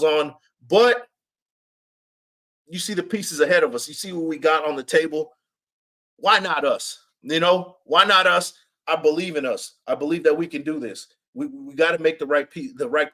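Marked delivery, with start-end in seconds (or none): none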